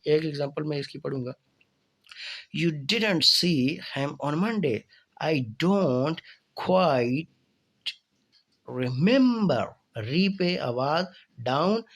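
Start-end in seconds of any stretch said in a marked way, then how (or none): 2.6-9.6 slow, "remember" falling then rising, louder; fall-rise intonation. Shown as a demonstration. Teacher showing fall-rise intonation with a slow example. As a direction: dip then lift on "remember", spoken slowly. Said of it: "fall rise का मतलब है पहले fall होगी फिर up होगी".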